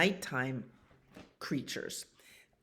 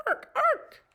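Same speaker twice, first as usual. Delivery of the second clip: very high, loud screeching jay call; jay scream; 4 dB louder than usual. A narrator's reading. Reading the blue jays to a child, high screech.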